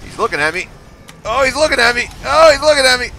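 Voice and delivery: building from plain to high, loud alarm; rising panic. Real, not acted. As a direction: panic rising as the monster looks at you. Monster turns toward him.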